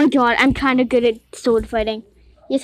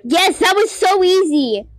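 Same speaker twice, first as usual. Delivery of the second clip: higher-pitched, slow gleeful cheer; triomphe; 7 semitones higher, 3 dB louder than usual. Real, not acted. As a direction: stretch it out in triumph, high-pitched. Celebrates winning a game round.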